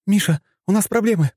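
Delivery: high-pitched worried female voice; Маша. A narrator's reading. Woman reporting trouble over a communicator, high and worried. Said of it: "услышал я голос Маши".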